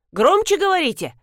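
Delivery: high-pitched mild demand; demanding request. A narrator's reading. Hard-of-hearing granny to her customer, demanding. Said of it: "попросила бабка".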